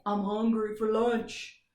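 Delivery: slow, a hint of a knight; a light knight voice; lightly voiced knight. Shown as a demonstration. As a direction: lazily say it like a knight. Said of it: "that knight might say".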